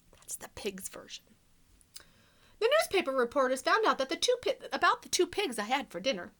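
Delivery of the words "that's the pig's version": soft unvoiced whisper; whispered aside. Real, not acted. Teacher whispering an aside to the children. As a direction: murmur it as a secret aside.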